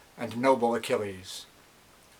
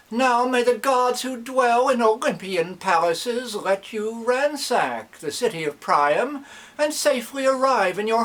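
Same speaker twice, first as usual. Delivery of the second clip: pleading priest; 9 semitones higher than usual. Acted. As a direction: beg the kings like an old priest. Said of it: "And he pled with them".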